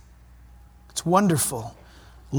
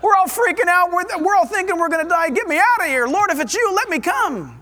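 hammed-up panic, high and urgent; Peter; 8 semitones higher, 3 dB louder than usual. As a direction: plead to escape in an acted fright. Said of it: "He's probably".

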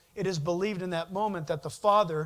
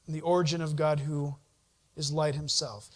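usual speaking voice

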